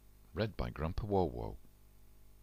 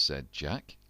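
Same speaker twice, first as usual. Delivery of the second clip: ordinary delivery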